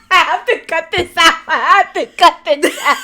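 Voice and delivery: laughing hard through the words; can barely get it out for laughing. Real, not acted. Host to guest, laughing while promising to cut the name.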